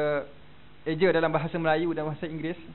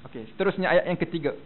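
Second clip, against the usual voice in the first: normal talking voice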